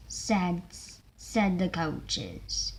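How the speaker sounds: ordinary delivery, nothing marked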